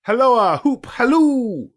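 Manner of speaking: high, loud, slow hunting holler; halloo call. A narrator's reading. Scrooge hollering after Friday, loud and high. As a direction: call across a field.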